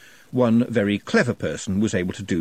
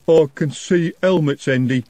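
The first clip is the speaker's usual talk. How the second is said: loud, raised urgent warning; 6 semitones higher, 5 dB louder than usual. A narrator's reading. Jumbo warning Andy of the watch, urgent. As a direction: warn the boss quick. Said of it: "said Jumbo urgently".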